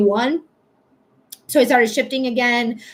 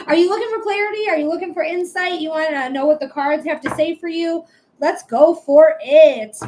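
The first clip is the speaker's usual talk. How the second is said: sales pitch; 4 semitones higher, 4 dB louder than usual. Acted. Live reading pitch.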